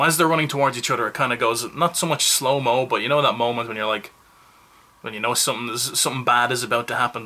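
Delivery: ordinary talk, nothing marked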